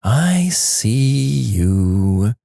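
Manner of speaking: very slow, drawn-out sing-song. A narrator's reading. Wife staring into dark corner, sing-song. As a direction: stretch each word into a creepy sing-song. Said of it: "in a soft sing-song voice".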